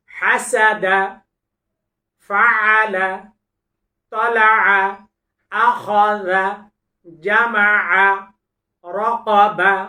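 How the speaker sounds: loud, deliberate syllable recitation; Quran recitation drill. Shown as a demonstration. Quran teacher reciting syllables for students to repeat.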